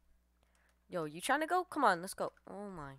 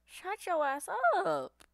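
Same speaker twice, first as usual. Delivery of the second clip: mock taunt; 11 semitones higher, 5 dB louder, much slower than usual. Taunting an enemy player mid-fight.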